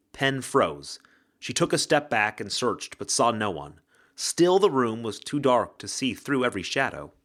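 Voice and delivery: plain reading voice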